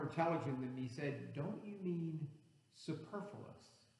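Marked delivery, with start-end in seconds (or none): 1.3-3.9 put-on soft, hushed old lady's correction; sweet old lady. Old lady correcting the preacher's word, sweet and soft. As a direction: gently query it, superfluous stressed on PER, almost whispered. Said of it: "a sweet old lady".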